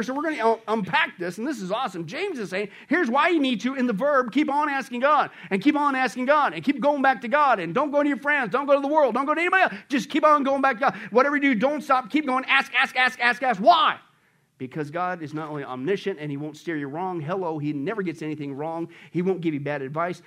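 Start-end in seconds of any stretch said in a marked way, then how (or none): 2.9-14.0 high, loud, emphatic preacher; full-force preaching. Real, not acted. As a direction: drive every point home at raised pitch.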